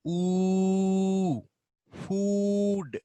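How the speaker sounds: food with a long, high, held oo. Shown as a demonstration. Teacher demonstrates the long oo sound, high and held. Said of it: "Then we have".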